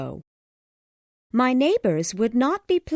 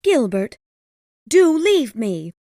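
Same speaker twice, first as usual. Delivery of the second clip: crying out; 11 dB louder than usual. A narrator's reading. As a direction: beg him to leave, distressed. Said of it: "she cried".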